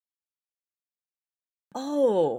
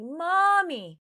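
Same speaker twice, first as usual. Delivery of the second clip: put-on high, loud wailing child voice; crying child; 10 semitones higher, 6 dB louder, much slower than usual. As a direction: imitate a little girl calling through tears. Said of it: "she's like".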